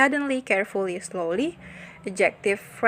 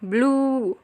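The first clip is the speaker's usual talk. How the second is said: "Blue" held long on a higher pitch; long stressed blue; 4 semitones higher than usual. Shown as a demonstration. Teacher demonstrating long stress to students, "Blue" held out. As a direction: stretch the vowel of "Blue". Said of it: "penekanan yang panjang".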